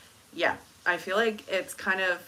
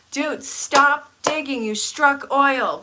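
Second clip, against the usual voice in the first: mock outburst; 5 semitones higher, 7 dB louder, slower than usual. Reading a teasing comment aloud.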